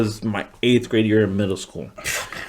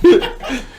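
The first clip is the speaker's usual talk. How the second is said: real high-pitched giggle. Real, not acted. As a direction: giggle high after the punchline. Laughing at his own story.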